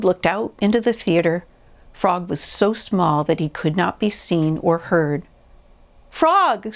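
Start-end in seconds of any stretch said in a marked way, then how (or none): 6.1-6.7 high, searching call; raised-pitch storybook call; Toad calling out. A narrator's reading. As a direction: call high for a missing friend. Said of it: "said Toad".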